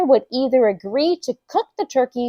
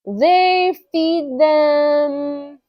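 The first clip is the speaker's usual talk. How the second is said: very slow, high, stretched-out words; unsure stalling; 5 semitones higher, much slower than usual. Acted. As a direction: drag each word out while hunting for an answer. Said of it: "Dave wasn't at all sure himself".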